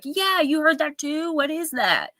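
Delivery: high-pitched imitation of astonished friends; retold surprise. Relaying friends' replies about the radio sounds, mock surprised.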